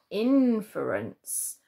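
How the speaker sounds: "inference" slowed, with a long first syllable IN; stress on the IN. Shown as a demonstration. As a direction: stretch the start of "inference", then let the rest fall. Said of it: "we don't say inference".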